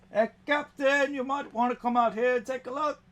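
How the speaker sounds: very high goblin shouting down a hallway; goblin calling out. Acted. Goblin calls the captain to come see, very high. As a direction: call the captain from outside like a goblin.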